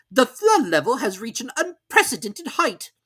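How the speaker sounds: very high, loud, slow hoot-like greeting; a pompous owl calling from the air. A narrator's reading. Owl voiced in story.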